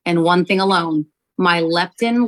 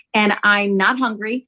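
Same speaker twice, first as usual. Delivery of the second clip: ordinary delivery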